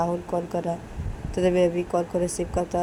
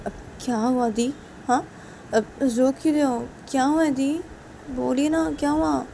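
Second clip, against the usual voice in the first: higher, pleading worried voice; worried younger brother; 7 semitones higher than usual. Acted. Brother pleads with his crying sister, higher and worried. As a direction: gently coax the sister to talk. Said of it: "श्रुति को बोलती कि".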